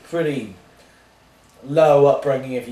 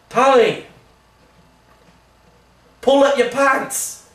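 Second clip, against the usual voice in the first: high, drawn-out shout from the side of the stage; yelling; 11 semitones higher, 5 dB louder, slower than usual. Acted. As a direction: call it out loudly across a distance. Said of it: "yelling to me".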